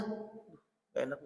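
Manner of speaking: slow, whispered reluctance; embarrassed whisper. Acted. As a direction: breathe it, too ashamed to say it. Guest too embarrassed.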